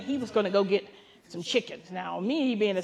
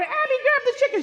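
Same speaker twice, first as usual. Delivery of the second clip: urgent call; 14 semitones higher, 6 dB louder, faster than usual. Retold or imitated. Urgent order to granddaughter.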